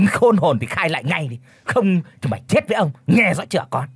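high, fast furious tirade; roaring rage. A narrator's reading. Red-faced chief rages at Thu, fast and furious. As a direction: tear into her, threatening. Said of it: "lão này chỉ thẳng cô mà gầm lên".

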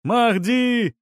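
high fervent cry; shouting. A narrator's reading. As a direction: hail a saviour from the crowd. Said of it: "кричал третий".

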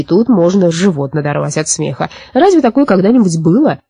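ordinary delivery, nothing marked

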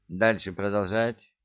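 usual speaking voice

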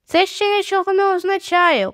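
high-pitched, insistent protest; stubborn objection. A narrator's reading. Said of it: "упирався він". (вперте заперечення)

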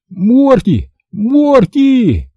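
high, drawn-out calls; desperate calling. A narrator's reading. Boy calls to dog drifting away, desperate.

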